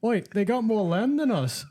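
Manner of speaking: high-pitched British colonist reporting in; eager mock-British messenger to the Prime Minister; mock British underling. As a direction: pitch it way up, calling to the boss. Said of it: "'cause they were like".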